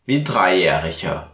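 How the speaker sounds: ordinary talk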